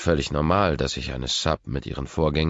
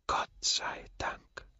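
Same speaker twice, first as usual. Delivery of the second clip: slow, whispered sigh of thanks; whispered relief; 8 dB quieter, slower than usual. A narrator's reading. Narrator privately relieved the offer still stands, whispered. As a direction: breathe it out slowly as a private thought.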